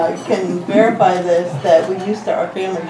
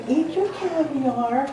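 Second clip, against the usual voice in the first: imitating a southern accent; 7 semitones higher, 5 dB quieter, much slower than usual. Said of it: "in her southern accent".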